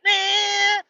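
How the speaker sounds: high, loud yelp of alarm; sudden high shout; startled shout. Retold or imitated. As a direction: cry out as a snake drops on you. Imagined snake falling on him.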